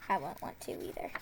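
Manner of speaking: breathy under-the-breath whisper; quick unvoiced whisper; whispered aside. Real, not acted.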